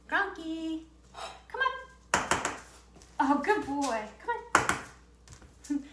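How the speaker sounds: high, cooing pet praise; talking to a dog. Real, not acted.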